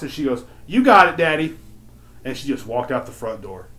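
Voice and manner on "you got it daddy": high, loud mock brush-off; dismissive daughter. Daughter leaves her dad to carry the box, dismissive. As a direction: imitate calling it out to Dad, punchy.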